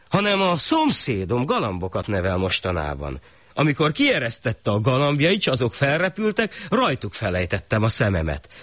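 the weaver excusing himself to the shah; mild pleading artisan. Acted.

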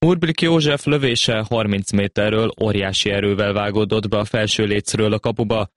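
normal talking voice

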